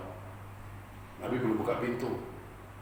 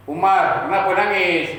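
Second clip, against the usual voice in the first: loud, higher demand; raised-voice questioning; 6 semitones higher, 7 dB louder than usual. Acted. Prophet asking Umar why he cries, loudly demanding. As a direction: call him by name and demand an answer.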